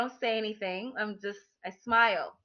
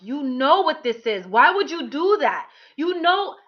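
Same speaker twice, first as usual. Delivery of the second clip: louder, higher reproach rising to the last you know; retold yelling; 4 semitones higher, 6 dB louder than usual. Former partner on the phone scolding her, retold yell. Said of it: "ever yelled at me".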